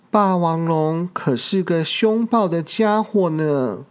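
ordinary delivery, nothing marked

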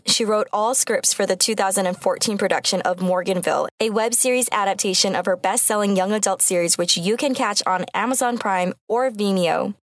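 normal talking voice

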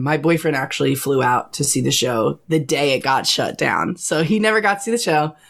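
ordinary delivery

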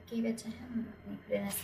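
hushed whispered aside; whispering. Real, not acted. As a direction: whisper it to the table. Quiet aside to party.

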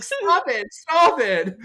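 high, squeaky mock protest; embarrassed protest. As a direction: squeal it like a flustered friend. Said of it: "And he's like".

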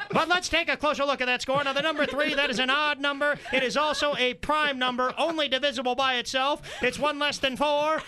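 high, emphatic sportscaster voice; field reporter. Acted. Field reporter analysing the score for viewers, mock earnest. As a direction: report the obvious with great seriousness. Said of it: "down to the field now for a special report".

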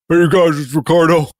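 normal talking voice

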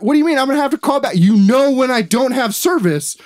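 high-pitched indignant outburst; outraged retort. Retold or imitated. Customer told to call back by cable company, outraged. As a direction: rant in exasperated disbelief, pitch way up.

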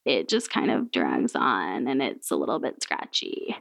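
low, slow, creaky vocal fry. Shown as a demonstration. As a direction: drag the words out in a scratchy creak. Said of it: "we get our voices like this".

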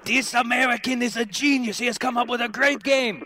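put-on high hammy German accent. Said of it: "That was my attempt in a German accent".

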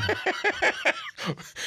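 high laugh. Real, not acted. Laughs at co-host's joke.

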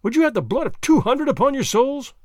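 pressing the accusation loudly; crying out. A narrator's reading. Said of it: "I cried".

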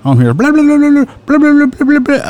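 mock yapping. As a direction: parody someone running his mouth nonstop. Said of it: "running his fucking mouth the whole time".

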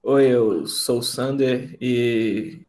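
very slow, dragged-out words; slow Sander voice. Retold or imitated. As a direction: drag every word out slowly. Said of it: "o Sander fala muito devagar".

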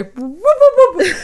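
high, loud whooping noise; fast forward sound. Shown as a demonstration. Her own fast-forward noise, whooped high and loud. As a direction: whoop like a tape zipping ahead. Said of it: "that's my fast forward sound".

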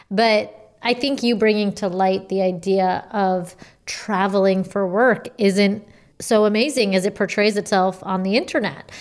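ordinary talk

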